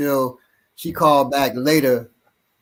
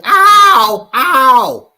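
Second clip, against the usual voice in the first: put-on high, loud little-girl whimper; toddler voice; 12 semitones higher, 8 dB louder than usual. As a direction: cry out like a hurting toddler. Two-year-old crying about her nose.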